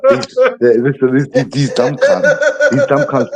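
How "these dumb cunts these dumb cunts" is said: laughing. Acted. Laughing high mock rant.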